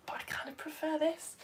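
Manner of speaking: soft confiding whisper; whispered aside. Real, not acted. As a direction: whisper it like a little secret. Confiding aside about teaching.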